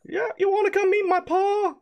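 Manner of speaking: very high squeaky goblin voice; excited little goblin squeak. Acted. Goblin Prince voice.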